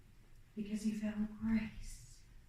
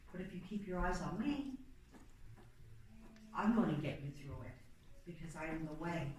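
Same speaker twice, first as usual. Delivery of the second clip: softer and lower, gently reassuring; reassuring Jesus; 4 semitones lower, 8 dB quieter than usual. Acted. Jesus comforting disciples who fell asleep, softer and lower. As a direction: ease into it like a promise.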